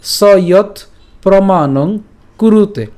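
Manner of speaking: slow, separated words with long vowels; broken-up recitation. Shown as a demonstration. Teacher drills the split verse, slow careful recitation. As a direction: draw each word out distinctly. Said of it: "এই ভাঙা অংশটুকু আপনারা এইভাবেই পাঠ".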